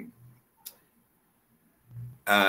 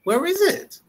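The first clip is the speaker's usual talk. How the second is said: very high questioning "Where is it"; rising tone. Shown as a demonstration. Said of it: "It's a rising tone there".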